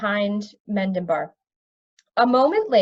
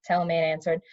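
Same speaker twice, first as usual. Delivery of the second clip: ordinary delivery, nothing marked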